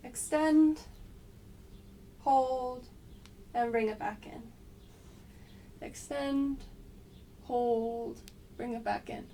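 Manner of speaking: calling the counts of an exercise; coaching cues. Shown as a demonstration. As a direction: cue each move crisply. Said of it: "starting now".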